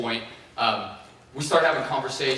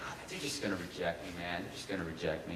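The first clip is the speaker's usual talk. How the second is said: much softer and lower, resigned; dejected mumble; 7 semitones lower, 13 dB quieter than usual. Retold or imitated. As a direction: mope it to yourself. Dejected reply to friend.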